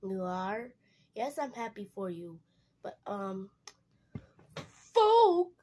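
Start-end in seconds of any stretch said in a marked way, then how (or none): 4.9-5.5 yelling out. Acted. Sudden outburst call.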